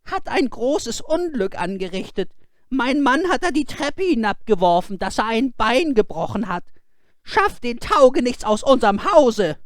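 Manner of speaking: high, loud indignant woman; upset wife. Acted. Sexton's wife complaining to the father, high and indignant. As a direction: demand the good-for-nothing be thrown out.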